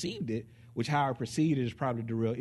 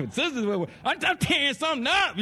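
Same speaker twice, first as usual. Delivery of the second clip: higher, louder rage through laughter; laughing mock rage; 13 semitones higher, 4 dB louder than usual. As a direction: play the raging wife while laughing. Angry wife acted, laughing.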